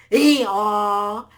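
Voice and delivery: long, blaring hee-haw; loud donkey bray. A narrator's reading. Donkey scaring off a lion, very loud bray. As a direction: bray loudly, stretching both syllables. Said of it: "he said it so loudly".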